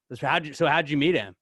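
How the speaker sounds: ordinary talk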